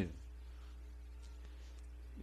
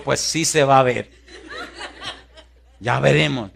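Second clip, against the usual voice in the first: laughing defiant aside; laughing reply; 6 dB louder than usual. Retold or imitated. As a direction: shrug it off with a laugh. Responde al desafío riendo. (respondiendo al desafío entre risas)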